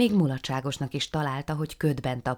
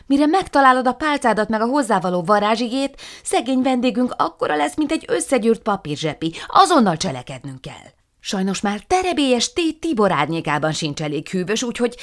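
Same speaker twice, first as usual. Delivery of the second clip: high, loud, pressing voice; urgent witch; 9 semitones higher, 10 dB louder than usual. Acted. Margaréta urgently argues with Matild, high and loud. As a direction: hurry her friend along with alarm.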